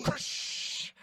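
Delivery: breathy whispered "Whoosh!" rush of air. Retold or imitated. Window blown out, imitated noise.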